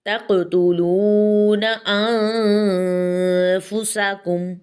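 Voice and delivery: slow chanted Arabic with a heavy qaf; tajweed recitation. Shown as a demonstration. Teacher demonstrates the verse for students, slow chant. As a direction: stretch the words like a recitation. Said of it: "तक़तुलून में क़ाफ जो है उसको हमने मोटा पढ़ना है".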